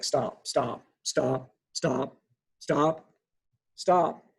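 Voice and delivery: cutting someone off with repeated stops, building to a punched last one. Retold or imitated. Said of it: "put some emphasis on a few words".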